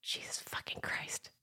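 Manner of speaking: very soft whispered curse. Shown as a demonstration. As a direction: swear in a whisper. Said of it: "top five words to whisper".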